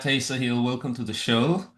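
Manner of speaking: ordinary delivery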